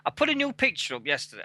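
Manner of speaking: normal talking voice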